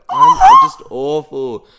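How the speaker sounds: mock wail, loud and drawn-out; slow, high, hammed-up self-pitying cry; retold crying. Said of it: "like, crying".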